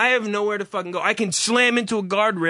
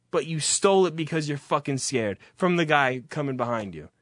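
ordinary talk

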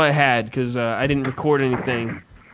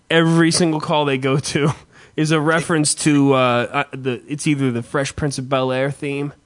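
ordinary talk, nothing marked

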